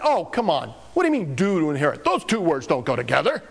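fast, scoffing put-down; mock scoffing retort. Preacher plays a bad Jesus scolding the man, scoffing. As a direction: brush the man off with a quick scoff. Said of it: "I would have just said".

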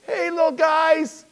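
high, drawn-out holler; calling out. Acted. Preacher playing Jesus hailing the disciples, high drawn-out call. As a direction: holler a greeting, stretched and high.

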